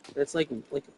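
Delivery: usual speaking voice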